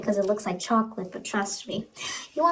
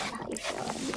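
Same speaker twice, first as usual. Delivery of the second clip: the same question, now whispered; 9 dB quieter than usual. Acted. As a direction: drop to a breathy hush.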